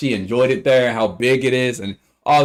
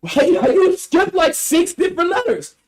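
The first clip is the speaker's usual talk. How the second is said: laughing disbelief; 4 dB louder than usual. Real, not acted. Laughing disbelief at the name mix-up.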